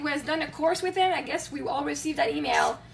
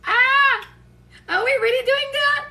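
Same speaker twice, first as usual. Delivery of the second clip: high, loud squeal into a question; thrilled disbelief; 7 semitones higher, 6 dB louder, slower than usual. Acted. People life brings together for an adventure, thrilled. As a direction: gasp it out, giddy and incredulous.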